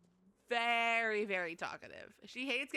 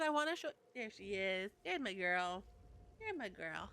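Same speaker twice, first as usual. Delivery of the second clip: normal talking voice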